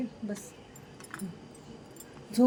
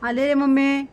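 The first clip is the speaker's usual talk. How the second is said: louder, higher call to the pet; 4 semitones higher, 6 dB louder than usual. Real, not acted. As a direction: sing out that mummy is coming. Calling back from another room. (मोठ्याने हाक मारत)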